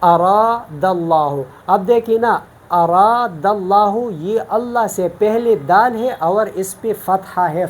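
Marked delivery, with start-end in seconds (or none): 0.0-1.3 slow, drawn-out recitation of اراد اللہ with a full, heavy اللہ; اراد اللہ with the lam of اللہ made heavy, Quranic style. Shown as a demonstration. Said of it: "مثلاً ادھر دیکھیں". 2.7-3.9 slow, drawn-out recitation of اراد اللہ with a full, heavy اللہ; اراد اللہ with the lam of اللہ made heavy, Quranic style. Shown as a demonstration. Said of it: "مثلاً ادھر دیکھیں".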